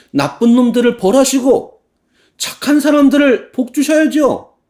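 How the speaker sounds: high, loud indignant pleading; heated complaint. Acted. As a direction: demand God punish the wicked. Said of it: "라고 하나님께 따져요".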